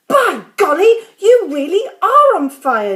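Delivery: loud, high exclamation; amazed. A narrator's reading. George seeing smoke pour from Grandma, amazed. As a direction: exclaim it in astonishment.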